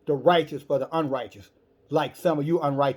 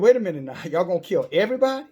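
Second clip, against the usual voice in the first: alarmed disbelief; 4 semitones higher, 5 dB louder, faster than usual. Acted. Abraham hears of the destruction.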